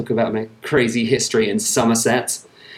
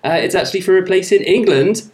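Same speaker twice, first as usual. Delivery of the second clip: higher, hammed-up Somerset accent all through; 6 semitones higher than usual. Host explaining his home accent to listeners, in a put-on Somerset accent. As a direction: lay the Somerset country burr on thick. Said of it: "They sound like this".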